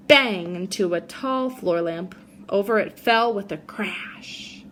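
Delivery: put-on gentle read-aloud for children; reading a story to a class; storybook reading. A narrator's reading. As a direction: tell it like a bedtime picture book. Reads picture book to kids.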